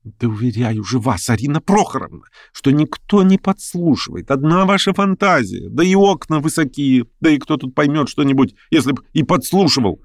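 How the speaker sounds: high-pitched, insistent objection; exasperated protest. A narrator's reading. Pianist assuring the hostess nobody listens, high protest.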